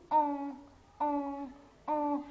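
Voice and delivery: EN as a long nasal "on" vowel; teacher demo of nasal EN; nasal sound. Shown as a demonstration. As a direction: hold EN through the nose, no hard n. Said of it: "se prononce nasal".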